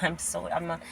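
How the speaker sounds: ordinary talk, nothing marked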